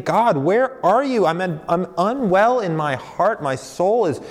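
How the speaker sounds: high, slow cry out to God; the psalmist calling out to God, slow and pained; anguished plea. Acted. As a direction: plead with an absent God, voice lifting. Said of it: "The psalmist is saying".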